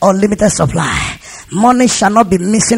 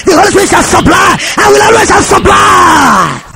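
preacher whipping up a congregational chant; fervent declaration; 3 semitones higher, 3 dB louder than usual. Real, not acted. Leads congregation in confession.

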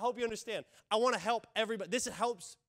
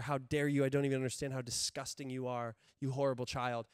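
condemning judge; 7 semitones lower than usual. Acted. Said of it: "condemning, wagging his finger".

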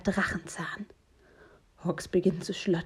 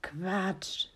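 dismissive brother; 5 dB quieter than usual. A narrator's reading. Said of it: "sagt sein Bruder".

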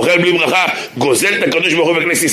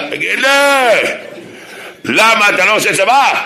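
1 challenging secular guy; 6 semitones higher than usual. Retold or imitated.

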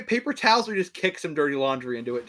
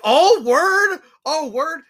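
very high, loud, impressed disbelief; mock amazement; 14 semitones higher, 13 dB louder, much slower than usual. Dad hears his kid kissed a girl, acted loud amazement. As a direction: exclaim it like a thrilled, incredulous dad. Father reacting to son's confession.

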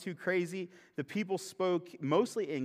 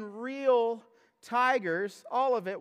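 "real tigers" drawn out slowly, louder and higher; insisting; 6 semitones higher, 5 dB louder, slower than usual. Real, not acted. Pastor to congregation, slow emphatic "real tigers". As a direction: lean hard on "real tigers".

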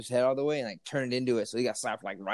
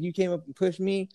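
mock retort; 8 semitones higher, 3 dB quieter than usual. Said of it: "He's like".

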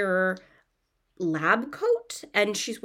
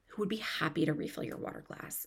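whisper; 7 dB quieter, faster than usual. Real, not acted. Aside about the sweet waiter.

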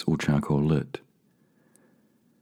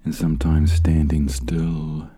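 slow, measured meditation guide with long pauses; spacious, calming delivery, letting each phrase settle; guided meditation; 3 dB louder than usual. Real, not acted. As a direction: lead them gently inward, unhurried.

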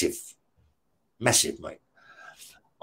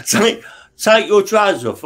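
a higher, louder mock cop command; a cop repeats his order, raised and insistent; louder repeated order; 6 semitones higher, 4 dB louder than usual. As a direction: bark the demand again, pushing.

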